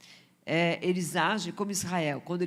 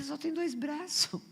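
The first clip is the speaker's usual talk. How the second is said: mocking retort; 8 semitones higher, slower than usual. Her retort to show-off.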